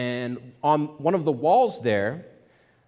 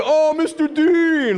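much higher, louder, slow delighted cry; surprised recognition; 8 dB louder, much slower than usual. Retold or imitated. Former student recognizing his old teacher, overjoyed. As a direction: stretch out a thrilled oh. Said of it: "And he's like".

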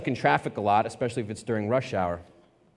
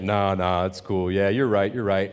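sheepish agreement; 6 semitones lower than usual. Retold or imitated. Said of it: "And he's like".

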